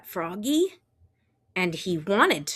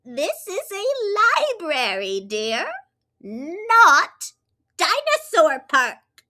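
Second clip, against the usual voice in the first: librarian; 9 semitones higher, 5 dB louder than usual. Acted. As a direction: correct him politely. Librarian voiced.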